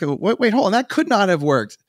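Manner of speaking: mock doctor surprise. Imitating a curious doctor.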